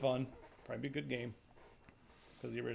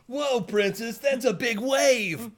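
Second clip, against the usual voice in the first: high, slow surfer dude; 8 semitones higher, 5 dB louder, much slower than usual. Pretend. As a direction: stretch it out like a stoked surfer. Surfer Link voice.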